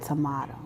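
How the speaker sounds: tomato as a very soft "tuh-MAH-toe"; low, hushed tomato with an "ah" vowel; sweet tomato. Retold or imitated. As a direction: say tomato gently, "mah" in the middle. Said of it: "they said sweeter than you".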